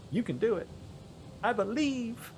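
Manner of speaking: pep the boat on, half-joking; mock cheering on. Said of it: "I'm like".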